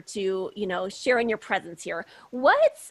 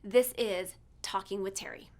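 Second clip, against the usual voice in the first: show tagline; 2 semitones lower, 6 dB quieter than usual. Acted. Host naming her show at intro's end, soft tagline. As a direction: drop it in like a trailer tag.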